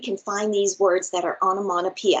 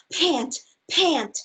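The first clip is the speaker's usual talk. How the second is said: out of breath; 5 semitones higher than usual. Acted. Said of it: "until he was out of breath".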